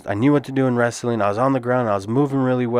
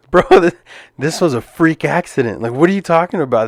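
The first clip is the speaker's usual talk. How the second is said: incredulous; 5 semitones higher, 3 dB louder than usual. Retold or imitated. Mocking opponent's post-fight interview.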